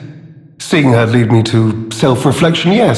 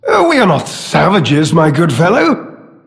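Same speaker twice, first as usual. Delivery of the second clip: mock sincerity; 2 semitones higher than usual. Villain claiming to abhor violence, theatrically insincere. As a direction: feign noble distaste while savouring it. Villain feigns love of peace.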